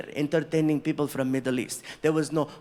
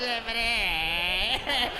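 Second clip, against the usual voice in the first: over-the-top greeting; 7 semitones higher, much slower than usual. Retold or imitated. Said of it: "I'm like".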